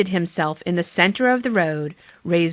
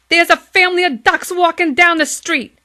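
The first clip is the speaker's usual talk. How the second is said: higher, louder excited policeman; officer calling headquarters; policeman Michael; 8 semitones higher, 7 dB louder than usual. Acted. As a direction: report it urgently down the phone. Michael phones Clancy.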